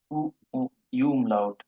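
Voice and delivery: "উ, উ, ইউ umlaut" drawn out, each sound held; very slow demo. Shown as a demonstration. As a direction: spell out "ইউ umlaut" very slowly for students. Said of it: "এগুলির উচ্চারণ হলো different". (খুব ধীরে "ইউ umlaut")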